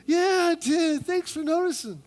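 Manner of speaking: put-on high, breathy-free girly reply; hammed-up pleased woman; mock-flattered woman voice; woman number two. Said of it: "A woman number two".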